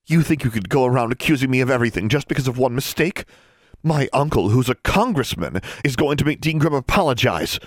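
high, haughty outrage; indignant tirade. A narrator's reading. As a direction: threaten her with your important uncle. Darla's indignant tirade in quarrel.

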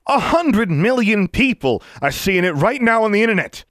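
high, loud furious yell. Acted. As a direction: yell it at the governor. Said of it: "Johnson yelled".

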